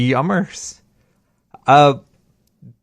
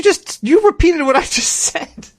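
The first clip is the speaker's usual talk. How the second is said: laughing; 3 dB louder than usual. Real, not acted. Catches friend repeating his words.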